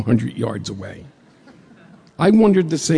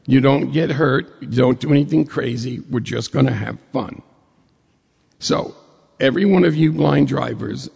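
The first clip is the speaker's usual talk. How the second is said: normal talking voice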